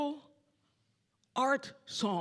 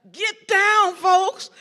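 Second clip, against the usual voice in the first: high, loud whoop of get down; excited cheer; 11 semitones higher, 15 dB louder, much slower than usual. Retold or imitated. Singer whooping at the dancing chorus to get down. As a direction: cheer the dancers on with glee.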